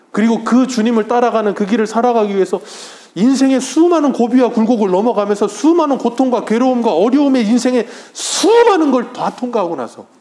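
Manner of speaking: high, loud, pressing sermon build; fervent preaching. Acted. Pastor urging the congregation through life's trials, loud and fervent. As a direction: drive it home with rising fervor.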